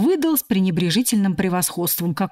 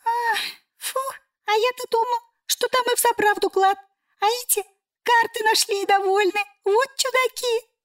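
squeaky cockroach voice. A narrator's reading. Cockroach disappointed.

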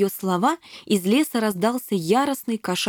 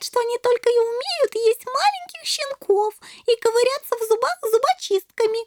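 put-on very high-pitched naive little-girl voice; chattering little princess; 14 semitones higher than usual. A narrator's reading. Shelli chattering to kittens about cats and mama, high girlish prattle.

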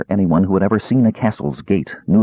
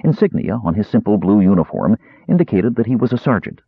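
ordinary delivery

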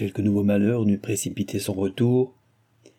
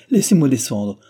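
raised, alarmed demand; frightened insistence; 5 semitones higher, 5 dB louder than usual. A narrator's reading. Cécile demands to get out, frightened insistence. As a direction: insist urgently, voice high. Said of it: "s'écria Cécile effrayée".

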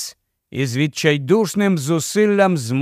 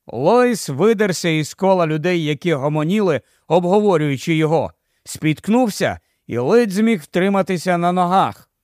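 plain reading voice

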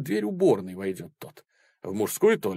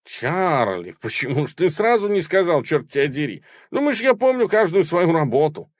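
excited recognition; 8 semitones higher, 6 dB louder than usual. Acted. Fixer suddenly recognises old client.

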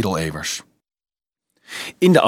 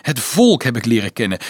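ordinary delivery

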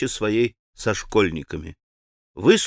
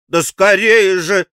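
louder, slowed, nervous; nervous urging; 7 semitones higher, 7 dB louder, slower than usual. Acted. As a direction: press them impatiently. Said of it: "нервно закончила она".

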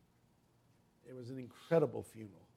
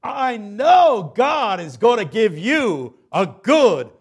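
higher, louder, slow triumphant farewell; joyful preaching shout; 8 semitones higher, 16 dB louder, slower than usual. Retold or imitated. Pastor promising the dead man glory, loud and triumphant. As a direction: swell into a victorious promise.

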